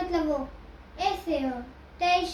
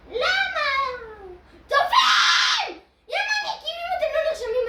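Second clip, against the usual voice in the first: mock angry yell; 8 semitones higher, 7 dB louder than usual. Ranting at viewers to subscribe, hammed-up fury. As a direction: scream at the viewers in fake rage.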